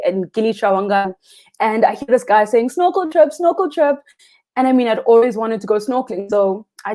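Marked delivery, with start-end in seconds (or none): 2.7-4.0 high sing-song hawker call; touting snorkel trips to passing tourists, sing-song call; calling out vendor. Retold or imitated. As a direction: call it out like a beach tout.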